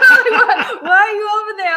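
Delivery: high, loud, laughing plea; excited call. Retold or imitated. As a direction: urge him over, bursting with glee. Retelling calling him over.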